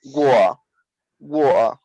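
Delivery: water clipped to war, dropping the end; British pronunciation. Shown as a demonstration. Teacher showing how some English people say water, cut-off English pronunciation. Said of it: "in England and they say".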